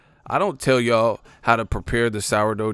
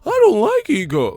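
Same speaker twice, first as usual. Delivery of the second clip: high, louder mock whine; mock hater; 11 semitones higher, 7 dB louder than usual. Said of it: "quoting a hater".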